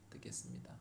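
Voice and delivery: breathy whisper of a prayer vow. Shown as a demonstration. Preacher re-enacting his prayer to God, whispering. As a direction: drop to a whisper and repeat it softly. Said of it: "제가 듣겠습니다".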